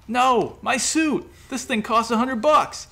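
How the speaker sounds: high, loud wailing dismay. A narrator's reading. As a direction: cry out over the ruined suit. Burnt costume dismay.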